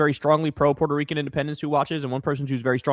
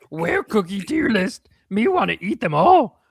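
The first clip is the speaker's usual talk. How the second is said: slow, high, gruff-free monster voice, far from own; a hungry puppet monster begging for cookies; 6 semitones higher, 4 dB louder, slower than usual. Pretend. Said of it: "Read in me voice".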